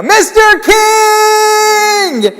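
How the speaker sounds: very high, long drawn-out shout; full-lung cry, stretched out; yell. Retold or imitated. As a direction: scream his name across the parking lot. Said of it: "yelling at the top of my lungs".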